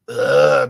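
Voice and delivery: loud, low exasperated groan; disgusted ugh. Real, not acted. Fed up with people excluding others, loud disgusted groan. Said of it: "I just get really, like".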